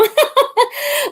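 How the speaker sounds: a burst of high giggling; high laugh. Real, not acted. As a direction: laugh up high at your own joke. Laughs at own joke.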